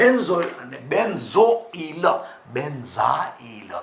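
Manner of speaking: "benzoyl" as ben-zo-il, then "benzyl" as ben-za-il; syllable-by-syllable pronunciation. Shown as a demonstration. Teacher contrasting the two names for students, slowly sounded out. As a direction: set slow zo-il against za-il in "benzoyl" and "benzyl". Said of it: "આખો ઉચ્ચાર આટલો".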